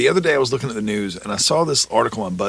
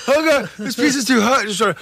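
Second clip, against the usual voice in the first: mock hot-pizza complaint; 9 semitones higher than usual. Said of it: "If she goes".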